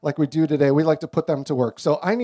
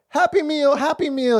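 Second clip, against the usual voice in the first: put-on high, eager small-child voice; little girl begging; 9 semitones higher than usual. Imitating a toddler begging her dad, high and eager. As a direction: chant it like an excited toddler. Three-year-old daughter asking.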